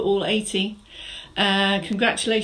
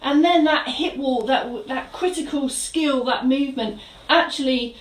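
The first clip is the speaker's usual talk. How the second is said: fitness instructor cueing; 3 semitones higher than usual. Acted. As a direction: coach the room through each move with energy. Leads a live mobility class.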